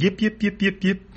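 high-pitched rhythmic yips; cartoon animal yapping; yipping creature. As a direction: yelp like a little dog on the run. Voicing the fleeing Ruddock's yips.

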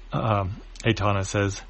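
plain reading voice

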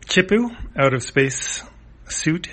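ordinary delivery

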